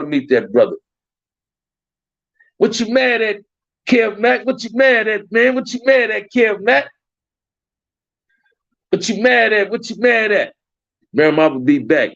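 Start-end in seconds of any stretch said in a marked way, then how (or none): 2.6-10.5 high-pitched, insistent repeated question; playful challenge. Real, not acted. Streamer asking a viewer what he's mad at, playful challenge. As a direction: needle him in a high, incredulous voice.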